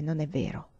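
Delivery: low, soft and cold. A narrator's reading. Vinca doubting her friend's answer, cold. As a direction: say it icily, dropped low and quiet. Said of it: "Fredda, Vinca disse".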